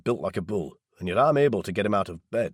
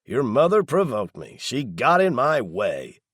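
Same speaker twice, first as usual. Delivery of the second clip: defensive anger; 5 semitones higher, 5 dB louder, slower than usual. A narrator's reading. Daryl defending himself angrily.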